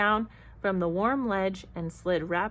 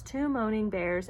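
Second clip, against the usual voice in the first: usual speaking voice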